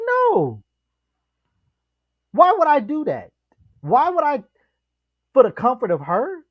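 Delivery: high-pitched, incredulous retort; mock-outraged pushback in a high voice; indignant refusal. Acting out man's indignant refusal.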